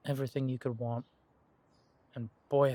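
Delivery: normal talking voice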